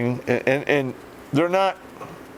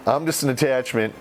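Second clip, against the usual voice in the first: normal talking voice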